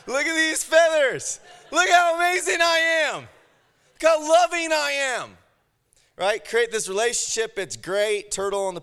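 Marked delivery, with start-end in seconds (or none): 0.1-5.4 high, loud boastful showing-off; slowing down to show off his feathers; preening peacock. Acted. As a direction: strut and crow about yourself.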